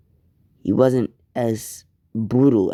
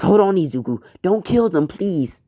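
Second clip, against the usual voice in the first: much higher, desperate begging; frantic mother begging her son to spare them; pleading mother; 7 semitones higher than usual. Acted. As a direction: beg him to stop before it's too late. Mother begs him to stop.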